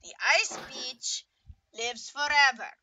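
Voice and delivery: Beach said with a long ee, close to bitch; vowel in beach. Acted. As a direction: say Beach as beeech, almost bitch. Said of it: "are you saying bitch or beach".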